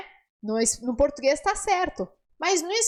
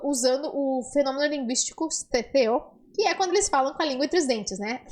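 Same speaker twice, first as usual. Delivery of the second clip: usual speaking voice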